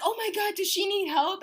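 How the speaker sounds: high-pitched, worried staff voice; worried Starbucks worker. Retold or imitated. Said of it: "they were like".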